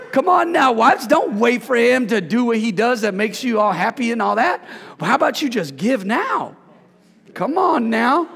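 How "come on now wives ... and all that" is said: ordinary talk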